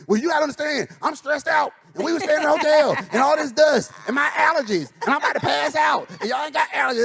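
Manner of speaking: high, fast, whining list of excuses; making excuses for jerkish behavior, high and rushed whine; whiny excuse. Retold or imitated. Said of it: "and say".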